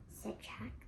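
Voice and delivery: ordinary delivery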